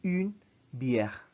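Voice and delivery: Une bière with rounded une; French pronunciation. Shown as a demonstration. Teacher repeating the example, clear pronunciation. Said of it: "Bière is beer in French".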